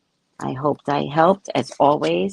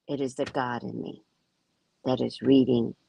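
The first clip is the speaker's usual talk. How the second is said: ordinary talk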